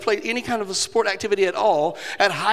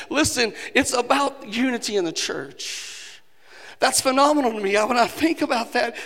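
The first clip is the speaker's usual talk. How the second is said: impassioned preaching; 5 semitones higher than usual. Acted. Preaching builds to a climax.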